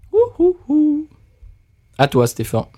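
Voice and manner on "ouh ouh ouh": high, delighted ouh ouh; delighted hooting. Real, not acted. Sniffing the beer, a high delighted hoot. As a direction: coo with pleasure at the smell.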